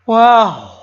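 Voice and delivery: high, loud wow stretched by a yawn; voice bent by a yawn. A narrator's reading. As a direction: yawn through the word. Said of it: "her voice affected by the yawn".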